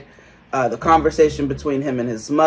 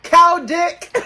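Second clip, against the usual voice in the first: mock brazen announcement; 9 semitones higher than usual. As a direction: proclaim it shamelessly in a raised pitch. Acted taunting message to Danielle.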